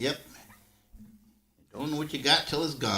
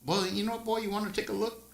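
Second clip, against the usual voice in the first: teasing; 12 semitones higher, faster than usual. Retold or imitated. Grandpa voicing himself to boy.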